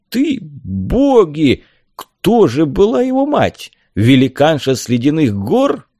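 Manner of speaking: high, drawn-out astonished outburst; flabbergasted. A narrator's reading. Helmsman asking who the mother was, flabbergasted. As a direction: swing up high in disbelief.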